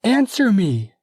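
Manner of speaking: very high, loud Answer me; furious order; angry command. Acted. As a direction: snap at the frightened maids. Gertrude commands.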